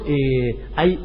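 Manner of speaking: usual speaking voice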